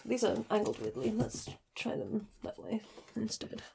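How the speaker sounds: muttered under breath; whispered aside. Real, not acted. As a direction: whisper to yourself, unvoiced. Blooper aside, muttered to self.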